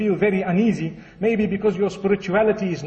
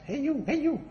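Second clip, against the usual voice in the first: high, softer bird call; talking parrot; 7 semitones higher, 6 dB quieter than usual. Acted. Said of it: "the same thing happens".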